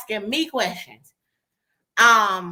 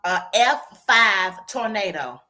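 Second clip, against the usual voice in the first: slow proclamation; 4 semitones lower, slower than usual. Retold or imitated. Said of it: "She says".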